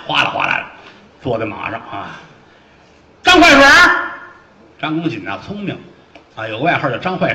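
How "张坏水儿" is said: high, loud call across the hall. Acted. Fat officer calling out to Zhang, loud and high. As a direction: hail him by his nickname.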